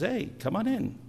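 high call across a field. Retold or imitated. Calling the farmer's sheep in, high call.

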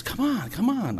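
coaxing call, pitched well up; urging call. Retold or imitated. Urging his volleyball teammate, high coaxing call. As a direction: egg the teammate on, come on come on.

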